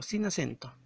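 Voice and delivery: usual speaking voice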